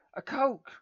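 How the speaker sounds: loud, high bratty shout; high shouted demand. A narrator's reading. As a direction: yell it like a spoilt kid. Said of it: "shouted a boy".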